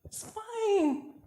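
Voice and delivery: small, high, soft "fine"; deflated denial. Acted. Repeats it after the outburst.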